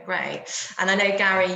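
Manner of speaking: ordinary talk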